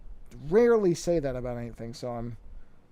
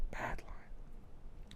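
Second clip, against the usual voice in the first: breathy whispered aside, much softer; 15 dB quieter than usual. Real, not acted. Muttering to himself about the bad line, whispered. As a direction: hush it to yourself. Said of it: "repeats the phrase as a whisper".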